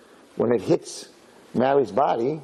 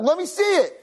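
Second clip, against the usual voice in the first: eager demand. Retold or imitated. Eager bride demanding present.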